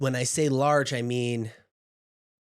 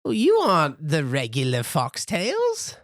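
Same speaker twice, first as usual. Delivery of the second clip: put-on a much higher old granny, fondly scolding; 4 semitones higher, 6 dB louder than usual. Granny scolding her grandson Jinx, high and fond. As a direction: play the fussing giant grandma, pitched way up. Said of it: "she's like".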